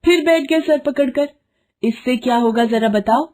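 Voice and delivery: high-pitched nagging wife; scolding wife. Acted. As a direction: scold him like an exasperated wife. Wife scolds despairing husband.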